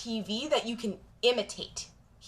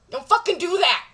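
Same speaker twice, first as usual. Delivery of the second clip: louder and higher, driving it home; firm loud warning; 6 semitones higher, 5 dB louder than usual. Real, not acted. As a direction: raise your voice on "fucking". Repeats warning, now louder.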